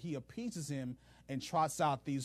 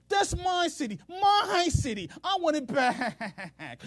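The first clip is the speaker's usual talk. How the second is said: much higher, louder, slow whining of That's my city; pouting tantrum; 12 semitones higher, 8 dB louder, slower than usual. Retold or imitated. Ahab pouting to his officials, whining tantrum. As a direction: stretch That's my city out like a sulking child. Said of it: "he's pouting".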